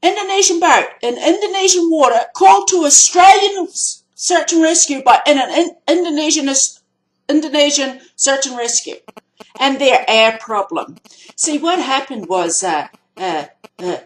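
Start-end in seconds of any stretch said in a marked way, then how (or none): 0.0-11.0 higher and louder, incredulous, hammering each word; indignant, driving the absurdity home; exasperated restating. Real, not acted. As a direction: repeat it in disbelief, building anger. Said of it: "angry restating of the facts".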